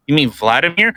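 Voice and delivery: ordinary talk, nothing marked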